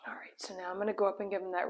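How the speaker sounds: fast whisper; hushed aside to viewers. Real, not acted. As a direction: whisper so the dog stays calm. Whispering near the dog.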